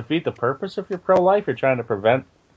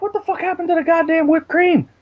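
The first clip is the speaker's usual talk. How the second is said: retold outburst; 12 semitones higher than usual. Retelling his outburst at the mess.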